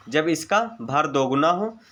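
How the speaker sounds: usual speaking voice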